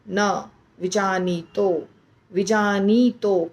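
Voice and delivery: विजानीतो said slowly with a long नी; slow drawn ee. Shown as a demonstration. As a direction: stretch the नी in विजानीतो. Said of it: "क्यों विजानीतो?".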